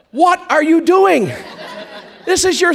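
high scolding inner voice with a laugh; mock conscience catching you mid-bite; nagging conscience. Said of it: "your conscience is going".

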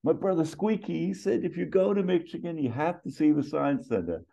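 high-pitched put-on chatter; high character voice; Axel Foley. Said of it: "and then Axel continues".

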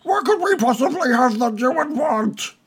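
high, drawn-out plaintive shout; exasperated high-pitched goblin boss cry. Acted. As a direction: wail it out in a high character voice. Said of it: "He just shouts out".

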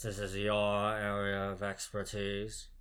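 slow, lightly voiced Snape; a hint of Snape's cold disdain, taken slowly; mock Snape, dragged out. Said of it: "Snape goes".

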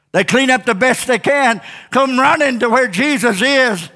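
high-pitched, emphatic preacher voice; fervent preaching. Real, not acted. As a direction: drive it home to the congregation, pitched high. Preaches with raised voice.

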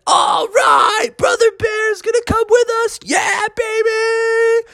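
very high shouted celebration; excited group cheer. Acted. The family cheering that Brother Bear is coming, high excited shout. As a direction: shout with joy as a crowd.

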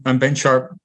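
ordinary delivery

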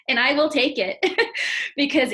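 words spoken through laughter; laughing. Real, not acted. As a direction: say it while still laughing.

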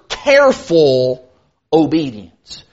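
"careful" stretched slow and low, then a pause; emphasis on careful. Shown as a demonstration. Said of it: "put a lot of emphasis on that word".

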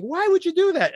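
squeaky high, baffled question; high mock quote. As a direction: pitch it way up in mock disbelief. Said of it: "like, going".

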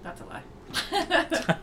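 high, slow, breathless laugh. Real, not acted. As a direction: laugh the confession out. Joking confession, laughing.

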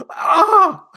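loud, high exasperated scream; mock scream. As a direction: cry out in exaggerated horror. Mock outburst over caps lock.

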